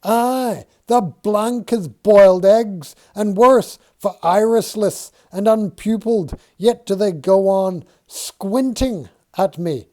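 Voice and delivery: high, loud agitated rant; agitated Dixon. Acted. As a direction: spill it out in a rush of dread. Dixon agitated about the eyes.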